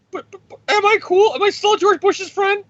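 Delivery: very high, squeaky, pleading voice; Tony begging Dick Cheney on a call, squeaky and high; whiny Tony Stark. Retold or imitated. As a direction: whimper it like a nervous kid. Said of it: "he's on a call with Dick Cheney".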